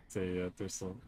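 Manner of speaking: ordinary talk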